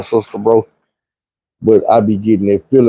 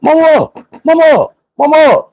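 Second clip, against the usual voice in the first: much higher, louder frantic cries of mama; panicked calling for mama; 3 dB louder than usual. Retold or imitated. Kid with a smashed nose calling for his mama, frantic. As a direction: call out for mama like a scared kid. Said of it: "I'm beating on the bathroom door".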